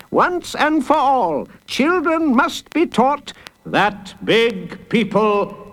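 high, slow, lecturing judge; stern judge. Acted. Judge teaching children a lesson, stern and emphatic. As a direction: lay down the law slowly, pitched high.

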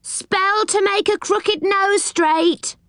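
very high, drawn-out street cry; slow, piping market call; high-voiced pixie hawker. A narrator's reading. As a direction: cry your wares like a squeaky pixie. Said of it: "crying their wares at the tops of their high voices".

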